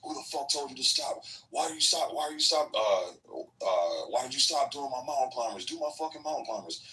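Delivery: mocking the drill instructor scolding the recruit who stopped, high-pitched rant. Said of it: "the drill instructor was like".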